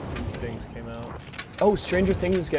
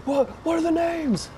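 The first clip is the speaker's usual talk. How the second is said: high, put-on voice for a quoted friend; quoting a friend; 4 dB louder than usual. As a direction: squeak out the friend's question. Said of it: "I just had T J tell me, like".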